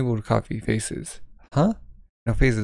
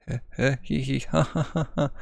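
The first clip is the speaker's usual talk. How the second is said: breathless giggles climbing into laughter; giggling fit; 2 semitones higher, 3 dB quieter than usual. Acted. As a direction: giggle helplessly, can't stop. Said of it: "Hey, what's so funny?".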